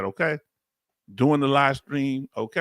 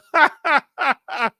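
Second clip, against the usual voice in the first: high laugh; 13 semitones higher, 6 dB louder than usual. Real, not acted. Streamer laughing at chat's joke, high laughter. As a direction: burst of high, loud laughter.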